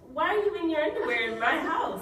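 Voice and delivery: laughing, high and indignant; outraged, half laughing. Retold or imitated. Acted indignant quote to uncle.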